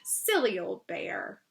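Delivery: soft, slow and tender; fond murmur; loving. A narrator's reading. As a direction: Christopher Robin fondly watching Pooh walk off. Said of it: "looked after him lovingly".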